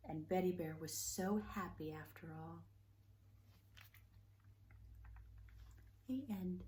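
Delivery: lively, animated read-aloud voice with big swings; storybook reading. Acted. Reading Betty Bear's birthday story to children, animated storytelling. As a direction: read it like a bedtime picture book for little ones. Reads a picture book aloud.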